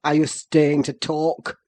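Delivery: low, loud sneer; angry sneer. A narrator's reading. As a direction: scorn him for dawdling. Heathcliff snarls at Hareton.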